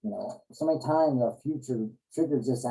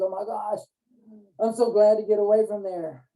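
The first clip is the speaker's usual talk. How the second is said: higher and louder, oh my gosh, so glad to escape; exasperated relief; 6 semitones higher, 4 dB louder than usual. Pretend. Someone drained by others, glad the day is over, exasperated higher louder relief. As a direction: blurt it out like you finally escaped a draining day.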